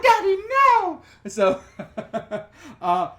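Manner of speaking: normal talking voice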